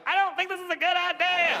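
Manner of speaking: scared whine. Pretend. Scared man on the helicopter, mock whining. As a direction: whimper like a frightened grown man. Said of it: "a thirty-year-old man like".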